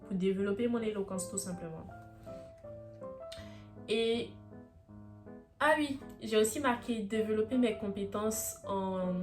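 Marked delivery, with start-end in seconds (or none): none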